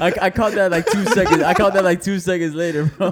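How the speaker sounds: talking through big laughter; laughing. Real, not acted. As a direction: laugh hard while getting the words out. Cracking up at a joke.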